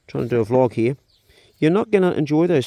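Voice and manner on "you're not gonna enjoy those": louder, exasperated telling-off; scolding a duck. Real, not acted. Vlogger scolding Super Duck mid-vlog, louder and exasperated. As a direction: tell the pest off while filming.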